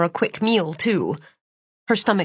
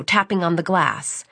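ordinary delivery, nothing marked

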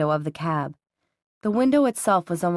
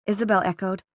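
ordinary delivery

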